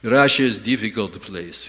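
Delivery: put-on low, heavy Russian accent; grim Russian-accented English; Russian driver. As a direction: play a gloomy Russian driver with thick accent. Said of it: "and he goes".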